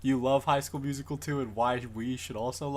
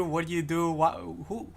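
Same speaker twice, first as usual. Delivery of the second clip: ordinary delivery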